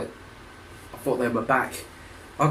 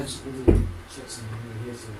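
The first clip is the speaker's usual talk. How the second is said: much softer, hushed aside; 4 semitones lower, 8 dB quieter, faster than usual. Real, not acted. Straining to hear a noise outside, hushed. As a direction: drop the voice to listen.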